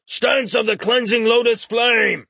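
high-pitched martial cry; battle cry. A narrator's reading. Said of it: "then cried out".

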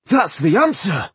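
loud, high burst of excitement; excited cry. A narrator's reading. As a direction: cry out at a sudden breakthrough. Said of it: "Tungdil cried excitedly".

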